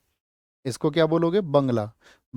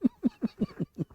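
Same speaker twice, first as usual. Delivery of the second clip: a high burst of laughter; sudden high laugh at a cheeky joke; real laugh; 6 dB quieter than usual. Real, not acted. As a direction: laugh it off, amused.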